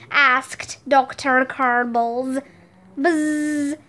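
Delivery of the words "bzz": drawn-out, monotone buzz; buzzer imitation. Quiz contestant hitting the buzzer, imitated buzz.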